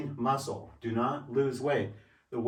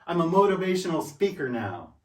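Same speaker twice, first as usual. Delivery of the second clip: proud declaration; 5 semitones higher, 5 dB louder than usual. Real, not acted.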